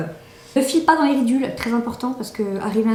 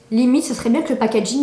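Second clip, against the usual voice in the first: ordinary talk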